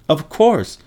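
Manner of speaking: high-pitched, mild scream; screamed affirmation. A narrator's reading. Anger answering by the shotgun window, mildly screamed. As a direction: cry it out as if indignant. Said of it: "screamed Anger by the shotgun window".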